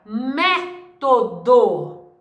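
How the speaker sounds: "método" in three beats, strong mé first; stress on first syllable. Shown as a demonstration. Teacher showing a proparoxítona, "método" broken into syllables with mé stressed. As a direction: punch the mé, then separate to and do in "método". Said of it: "É o mé".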